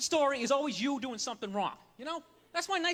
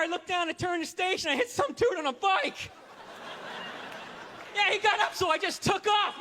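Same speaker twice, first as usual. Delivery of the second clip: louder, higher bellowed storytelling; loudly told story; 4 semitones higher than usual. Acted. Some guy telling a crazy story twenty rows back, loudly. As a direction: shout a secret story across the bus. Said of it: "Don't you wish good stories were told loudly?".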